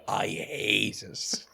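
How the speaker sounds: slow, breathy shout-whisper of disgust; forced whisper-shout. Real, not acted. Telling viewers about poetry, a slow whispered shout. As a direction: hiss it out slowly, fed up.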